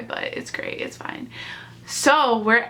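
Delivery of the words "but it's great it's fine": real choked-back whisper, much softer; near tears. Real, not acted. Fan telling the story is about to cry, near-tears whisper. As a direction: voice falls away to a whisper, holding back tears. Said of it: "so I'm about to cry".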